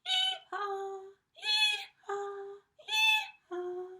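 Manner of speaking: very high, very loud braying; donkey bray. Acted. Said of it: "started to make his donkey noise".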